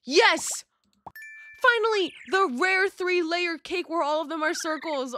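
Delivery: loud, high cheer; high-pitched, elated outburst; thrilled. Real, not acted. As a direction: burst out in celebration. Thrilled at getting favourite theme.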